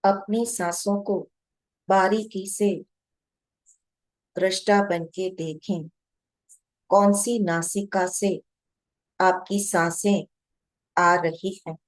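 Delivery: measured meditation guide, long pauses between short phrases; guided meditation. Real, not acted. Guide to meditators watching their breath, calm guided meditation. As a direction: guide them unhurriedly, phrase by phrase, leaving long silences.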